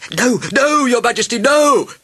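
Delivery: high, loud panicked protest; frantic high-pitched shout; shouting doctors. A narrator's reading. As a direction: shout in alarm to stop the king. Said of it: "shouted the doctors as one doctor".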